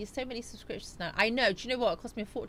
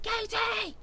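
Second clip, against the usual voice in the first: high squealed call; shout-out; 13 semitones higher than usual. Retold or imitated. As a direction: squeal the name out in a playful shout-out. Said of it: "doing a shout out for Kate".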